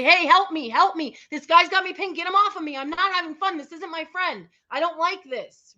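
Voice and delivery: high, loud cry for help; loud, high-pitched call for help, pinned by a bully. Shown as a demonstration. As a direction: shout for help across the hallway.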